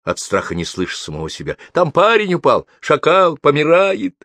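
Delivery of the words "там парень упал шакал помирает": high, loud cries for help; scared boy hollering; frightened yelling. A narrator's reading. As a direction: shout the alarm in a panic. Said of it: "орал я благим матом. От страха".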